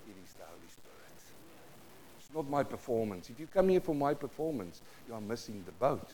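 ordinary talk, nothing marked

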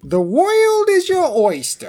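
"The world is your oyster" pitched high and grand; mock grand proclamation. Cohost echoes the saying in a high grand voice, mock declamation. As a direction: declaim it like a pompous toast. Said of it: "Now I say this all the time".

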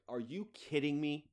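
aghast, hammed-up indignation; incredulous mock outrage. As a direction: play a put-upon company in comic disbelief. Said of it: "poor bastards at Corona must be just like".

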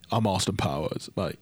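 low, soft mock British accent; understated British impression; Flash Morgan Webster. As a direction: sink your voice into a put-on British wrestler. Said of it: "And you got Flash Morgan Webster".

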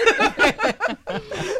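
high burst of laughter; sudden loud laughing fit. Real, not acted. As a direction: let a big real laugh out.

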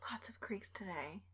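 breathy spooky whisper. Acted. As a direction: whisper it like a ghost story. Cohost echoes it spookily.

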